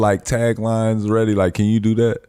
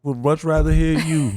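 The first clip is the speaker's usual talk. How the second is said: normal talking voice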